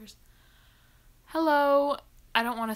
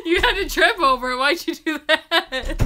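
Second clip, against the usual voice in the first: loud laughing tease; 6 semitones higher, 10 dB louder than usual. Real, not acted. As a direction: laugh at him while asking. Teasing brother who tripped.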